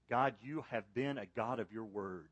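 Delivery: ordinary delivery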